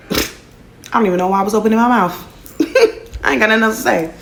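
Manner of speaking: high laughing self-mockery. Real, not acted. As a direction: laugh through the words. Laughing at own story.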